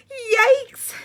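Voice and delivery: very high, loud shriek; yelled. Acted. Mother hearing the bad news, shrieking yell. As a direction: shriek in alarm. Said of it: "yelled her mother".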